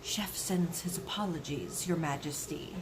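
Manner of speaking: breathy unvoiced whisper; hushed servant's whisper. A narrator's reading. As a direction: whisper it into her ear. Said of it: "whispered in her ear".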